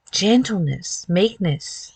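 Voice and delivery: ordinary delivery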